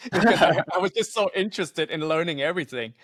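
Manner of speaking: giggly self-mocking admission; laughing. Real, not acted. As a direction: laugh through the line at your own joke. Laughs at his own joke.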